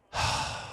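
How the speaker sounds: long unvoiced sigh; deep sigh. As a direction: let out a heavy sigh. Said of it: "He sighs deeply".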